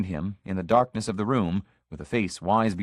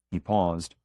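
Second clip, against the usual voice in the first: usual speaking voice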